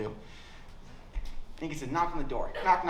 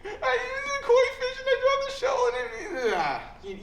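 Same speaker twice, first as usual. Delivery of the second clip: put-on much higher, whiny kid confessing through laughter; mock kid confession; 4 dB louder, slower than usual. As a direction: crack and blurt it all out. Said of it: "I folded hard".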